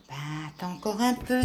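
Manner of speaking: dog begging softly; pleading. Acted. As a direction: plead gently for patience. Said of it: "supplie Toufou".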